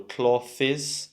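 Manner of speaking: "clothes" split as CLOTH-iz; short-o, two-syllable "clothes"; students' wrong clothes. Retold or imitated. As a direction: imitate the mistake, "clothes" with th and extra iz. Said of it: "They say something like".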